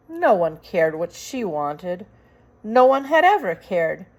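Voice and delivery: plain reading voice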